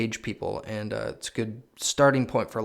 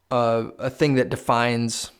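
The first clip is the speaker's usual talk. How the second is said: ordinary delivery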